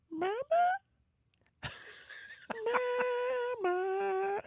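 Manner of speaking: put-on high, babyish "Mama?"; mocking baby talk. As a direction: talk to him like a crying baby. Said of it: "looks at me and goes".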